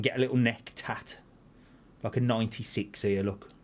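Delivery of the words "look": normal talking voice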